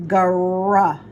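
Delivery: "gr." as a short, loud blend with no vowel after; isolated sound. Shown as a demonstration. Teacher models the starting blend for the child, isolated. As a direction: punch out the "gr." sound by itself. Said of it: "You hear the R?".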